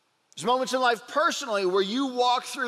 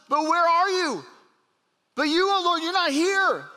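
raised, pleading cry, much higher and louder; crying out to God; 6 semitones higher, 4 dB louder than usual. Retold or imitated. Preacher voicing the psalmist crying out to God, pleading cry. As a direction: call out in anguish to an absent God. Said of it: "And then cries out and says".

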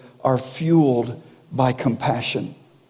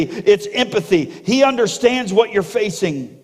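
raised, ringing preacher's voice; full-force preaching; 5 semitones higher than usual. Acted. Pastor urging the congregation, preaching at full force. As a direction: preach it out to the whole room.

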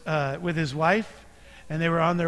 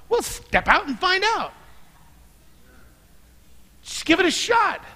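high and playful; 10 semitones higher than usual. Acted. Preacher's punchline, high voice.

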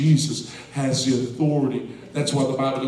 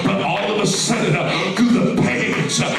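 raised, chanting cadence broken by "uh"s; rhythmic preaching; 8 semitones higher, 5 dB louder than usual. Real, not acted. Preacher on hearts hindered by life's pains, rhythmic fervent preaching. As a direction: drive each short phrase like a revival preacher.

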